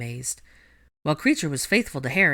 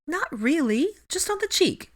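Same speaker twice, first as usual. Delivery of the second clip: much higher, squeaky embarrassment; flustered young witch; 14 semitones higher than usual. A narrator's reading. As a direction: shrink from the question. Hermione voiced high.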